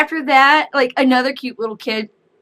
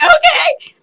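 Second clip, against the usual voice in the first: very high, loud squeal; happy kid agreeing; little girl voice; 10 dB louder than usual. Retold or imitated. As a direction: chirp it like a delighted toddler. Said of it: "She's like".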